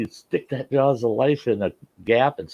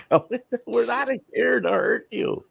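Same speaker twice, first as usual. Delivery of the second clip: slow calming reply; slower than usual. Retold or imitated. Rescuer calmly answering.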